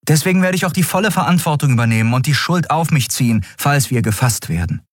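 a hint of a defiant young captain; lightly voiced Endriel. A narrator's reading. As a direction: rush out the promise. Endriel takes the blame.

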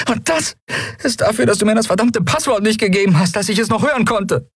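high, scolding woman's voice; a heroine scolding between slaps; Endriel's voice. Acted. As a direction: raise the pitch and scold him half-laughing. Endriel scolds Kai while slapping.

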